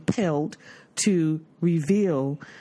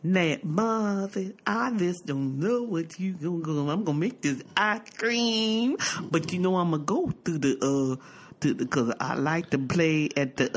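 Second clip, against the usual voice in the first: imitating him. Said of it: "he was like".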